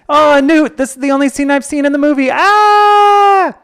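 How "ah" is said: high sudden cry; short high-pitched shriek; startled yelp. Real, not acted. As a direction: squeal at your own mistake. Player jumps the scene.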